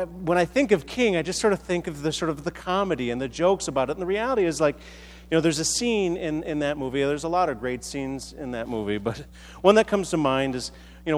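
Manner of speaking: usual speaking voice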